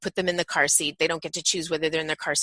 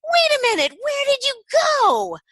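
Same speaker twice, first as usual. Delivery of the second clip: mock surprise; 7 dB louder than usual. Shown as a demonstration. Mock searching for the child.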